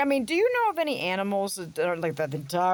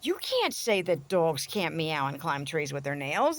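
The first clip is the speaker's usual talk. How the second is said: ordinary talk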